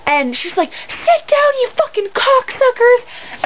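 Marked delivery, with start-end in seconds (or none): 0.9-3.0 very high imitation of a raging mom; hammed-up scream without full volume; mock screaming. Imitating a drunk mother screaming at her kids, mock screaming. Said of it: "you're, like, screaming at your kids".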